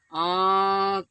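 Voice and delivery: आँख stretched long and loud, air through nose and mouth; nasalized vowel. Shown as a demonstration. Teacher repeating the example for students, long nasalized आँख. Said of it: "नाक और मुख दोनों के दबाव से".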